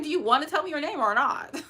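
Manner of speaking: sassy, loud, high demand; exasperated high retort. Retold or imitated. Imagined reply to the ghost, loud and sassy. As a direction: demand his name, fed up. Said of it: "I would have been like".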